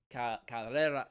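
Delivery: Carrera with a halting, failed attempt at a rolled R. Shown as a demonstration. Streamer trying to roll the R and giving up, failed rolled R. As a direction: stumble into Carrera trying to trill the R. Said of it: "I can't roll my R's".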